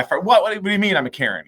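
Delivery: high, indignant accusing question; accusatory retort. Retold or imitated. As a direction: shoot back an accusing question. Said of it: "I do it as a accusatory".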